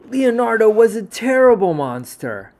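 loud dramatic read; booming storyteller. A narrator's reading. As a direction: announce it like a grand tale.

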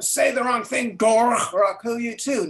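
a loud mock threat pitched high, punching Dorg; angry threat. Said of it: "he swings around".

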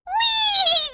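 high, drawn-out squeal of joy; gleeful whee. Acted. Girl delighted after gliding down, gleeful high whee. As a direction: let out a high "whee" mid-glide.

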